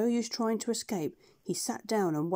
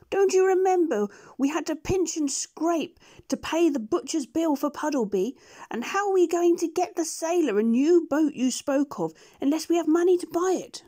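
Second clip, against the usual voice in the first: higher, louder duck voice; scolding duck; 8 semitones higher, 6 dB louder than usual. Acted. Dab Dab scolds the doctor about money, high scolding duck. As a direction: scold like a sharp housekeeping duck. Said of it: "said Dab Dab the duck".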